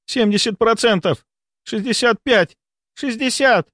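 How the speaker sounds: high, urgent countdown, climbing in pitch; escalating alarm. A narrator's reading. A medic reads out dropping saturation, increasingly alarmed.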